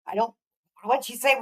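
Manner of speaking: ordinary talk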